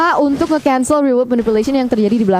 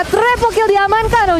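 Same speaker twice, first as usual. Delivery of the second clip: hyped call; 7 semitones higher, slower than usual. Real, not acted. Hyped triple kill call.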